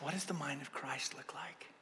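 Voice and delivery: a soft whispered inner question. Retold or imitated. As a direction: whisper it to yourself. Inner thought at car lot.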